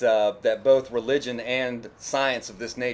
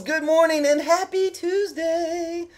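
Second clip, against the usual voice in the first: high, slow, loud sing-song greeting; stretched-out excited welcome to the viewers; hype intro greeting; 7 dB louder, slower than usual. Acted. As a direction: belt out the channel hello like a catchphrase. YouTuber's signature channel greeting.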